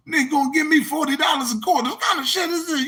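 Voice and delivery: high, fast outraged voice; mock indignant owner. Club owner complaining about forty dollars in quarters, high and fast. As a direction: sputter it in disbelief.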